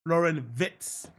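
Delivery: slow "Wits" said as "Vits"; correct name pronunciation. Shown as a demonstration. Host repeating the player's name for viewers, slow "Vits" for "Wits". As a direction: stretch out the name, "Wits" with a V and short i. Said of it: "My name is Florian Wits".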